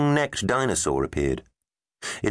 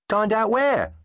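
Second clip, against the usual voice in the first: eager. A narrator's reading. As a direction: beg to come along for food. Tegs eager about food.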